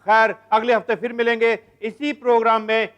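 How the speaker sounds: loud, projected sign-off; show announcer. Acted. Host closing the programme till next week, loud announcer delivery. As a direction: announce it big, stretching the show's name at the end.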